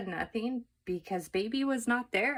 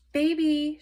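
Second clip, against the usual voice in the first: louder, higher calling shout; 9 semitones higher, 8 dB louder than usual. A narrator's reading. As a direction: call across the house. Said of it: "shouted Eugenia".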